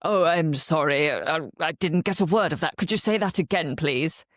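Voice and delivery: put-on voice of a politician apologising; imitated politician. MP addressing a Scottish colleague in the House, put-on apology. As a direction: imitate an MP politely asking for a repeat. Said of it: "the person he's addressing says".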